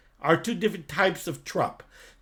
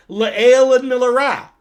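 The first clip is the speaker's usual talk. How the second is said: Le'el and milara called out loud and high; louder, slower teacher emphasis; emphatic teaching call; 5 semitones higher, 9 dB louder, slower than usual. Shown as a demonstration. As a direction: announce the two terms like a teacher making a point. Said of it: "you have to emphasize the".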